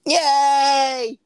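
high, loud drawn-out cheer; gleeful cheer. Pretend. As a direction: let out a long, high, playful yay. Mock cheer at nude scene.

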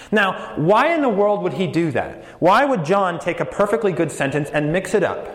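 normal talking voice

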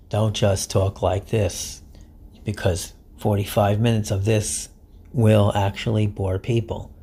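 low, soft, droning monotone read; monotone demo. Shown as a demonstration. Podcaster showing listeners the boring monotone voice, low droning monotone. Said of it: "I'm talking monotone".

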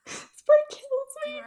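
high, slow, tearful voice; teary. Real, not acted. Fan moved by a scene, tearful and high. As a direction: speak through happy tears.

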